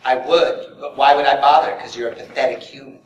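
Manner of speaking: cool, dismissive android sneer; cold, bored android put-down. Pretend. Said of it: "say something really mean to me in an Android seventeen voice".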